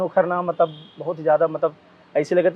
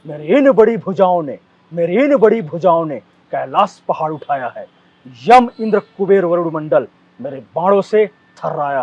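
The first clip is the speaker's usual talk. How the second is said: loud, booming Ramlila warrior speech; dramatic stage dialogue; 7 dB louder than usual. Shown as a demonstration. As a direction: declaim it like Angad on stage. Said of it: "मैं जरूर करके दिखाऊँ".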